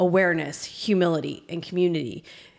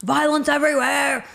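yelling; 8 semitones higher, 4 dB louder than usual. Retold or imitated. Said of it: "He's literally yelling".

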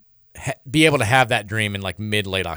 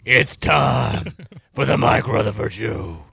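hammy slow announcer voice; mock big radio announcer; slower than usual. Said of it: "doing a different voice for the radio".

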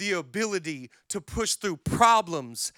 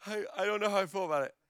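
uneasy mock mumble; 8 dB quieter, much faster than usual. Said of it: "you're like".

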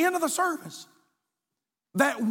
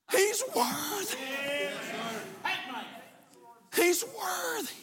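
high but much softer, drawn out; hushed cry of worship; 4 semitones higher, 6 dB quieter, slower than usual. Acted. Pastor softly echoing after the climax. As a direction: breathe it out high and soft.

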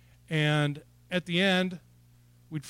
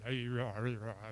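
low, soft mumble to himself; mock mumbling; 5 semitones lower, 8 dB quieter than usual. Preacher mimicking himself in the back row, low mumbling. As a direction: imitate muttering away under your breath.

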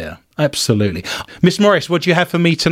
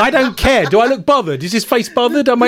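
mock indignant; 8 semitones higher than usual. Joking denial of being bothered.

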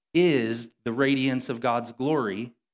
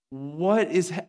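higher-pitched disbelief; mock astonishment; 7 semitones higher, slower than usual. Gym crowd watching the cleaner deadlift, mock astonished. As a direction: act out stunned onlookers, pitched up. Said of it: "they're, like, losing their minds".

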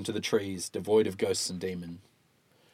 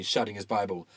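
ordinary delivery

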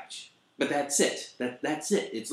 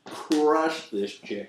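usual speaking voice

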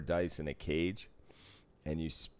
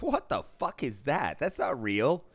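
baffled; 8 semitones higher, 4 dB louder than usual. Retold or imitated. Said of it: "And I was like".

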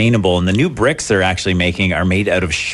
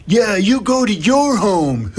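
high, drawn-out taunting player; mock yell; 11 semitones higher, slower than usual. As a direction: imitate the yelling player, pitched way up and slow. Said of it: "yelled at the ump".

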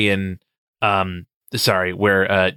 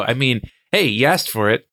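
usual speaking voice

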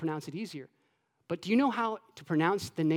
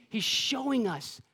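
ordinary talk, nothing marked

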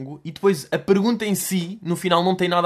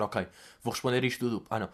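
quiet, hurried inner voice; muttered confused thoughts; 5 semitones lower, 8 dB quieter, faster than usual. Retold or imitated. The guest quietly losing track of the question.

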